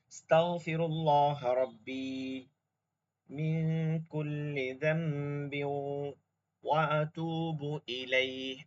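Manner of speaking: slow, careful recitation of the dua. Shown as a demonstration. Teacher reciting the repentance dua to the class. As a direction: recite it slowly and piously.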